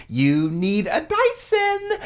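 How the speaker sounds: slow, high waitress savoring the line. Retold or imitated. Dad retelling the waitress recommending a vacuum, slow and high. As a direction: stretch it out high like a delighted waitress. Waitress voiced in retold scene.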